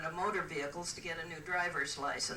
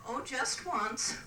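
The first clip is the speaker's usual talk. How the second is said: higher, drawn-out coy answer; coy reply; 5 semitones higher, slower than usual. Acted. Lady answering the man she fancies, coy. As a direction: stretch it out with mock innocence. Woman's coy punchline about marriages.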